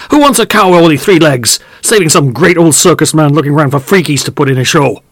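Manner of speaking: high, loud furious farmer; angry farmer shouting. Acted. Farmer shouting at the old farmhand about the lost leg, furious. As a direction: bellow at the old fool over the lame cow. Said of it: "shouted another voice".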